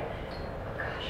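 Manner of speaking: hushed, voiceless murmur; hushed reaction. Acted. Colleague watches the upset resident, hushed. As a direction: say it very softly, almost to yourself.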